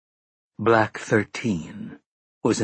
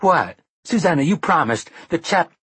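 higher, louder startled objection; shocked protest; 7 semitones higher, 3 dB louder than usual. Acted. Mia protesting to Susanna about the chap, startled.

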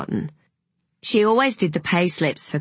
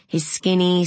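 ordinary delivery, nothing marked

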